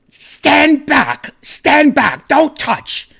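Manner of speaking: high-pitched, loud shout; angry British women. Retold or imitated. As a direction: imitate British stewardesses yelling orders. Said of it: "angry at you".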